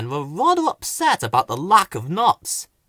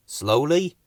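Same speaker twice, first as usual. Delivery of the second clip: plain reading voice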